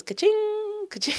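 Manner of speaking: high, slow ka-ching; playful ka-ching sound effect; cash-register sound. Shown as a demonstration. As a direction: sing the app's cash chime. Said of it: "This is clearly just my own app".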